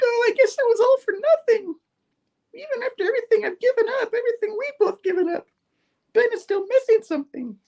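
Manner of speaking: very high falsetto imitation of a woman; mock Janine voice. Retelling Janine's counseling complaint, in mock falsetto. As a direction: put on a squeaky-high, put-upon woman. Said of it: "And she's like".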